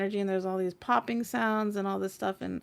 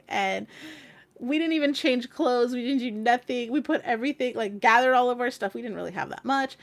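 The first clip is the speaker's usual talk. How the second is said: ordinary delivery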